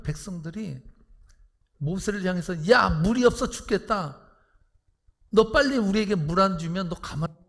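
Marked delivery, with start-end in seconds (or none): 2.7-7.4 angry clamour. Retold or imitated. Thirsty people turning on Moses, angry clamour. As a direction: shout it as a threat. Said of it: "이렇게 아우성치는데".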